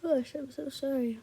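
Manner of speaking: apologizing out of a real yawn; words stretched by a yawn; tired, yawny aside to listeners. Real, not acted. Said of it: "I'm sorry for yawning so much".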